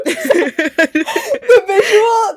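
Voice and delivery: high, loud, laughing through words; can barely speak for laughing; laughing hard. Real, not acted. Laughing about headbutting the mic.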